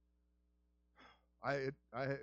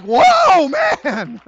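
much higher, shouted whoa of amazement; be floored by the voice on the phone; amazed whoa; 9 semitones higher, 13 dB louder than usual. Retold or imitated. Hearing sick friend talk on phone, shouted amazement.

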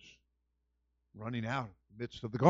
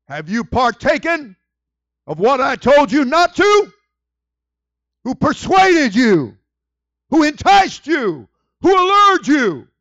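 very high, loud, slow questions; God confronting Adam and Eve, booming; God thundering; 13 semitones higher, 20 dB louder, slower than usual. Pretend. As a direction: thunder at them like an angry father. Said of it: "God said".

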